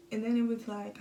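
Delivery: normal talking voice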